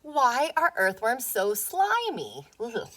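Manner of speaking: ordinary talk, nothing marked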